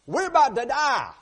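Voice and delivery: high, loud cry of despair; panicked cry. Acted. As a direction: cry it out as if death is near. Widow fearing death in crisis.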